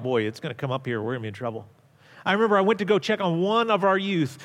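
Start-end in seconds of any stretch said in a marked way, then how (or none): 0.0-1.7 softer, lower, muttered to himself; voicing a worried private thought; inner thought, low. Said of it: "I thought".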